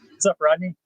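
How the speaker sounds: ordinary delivery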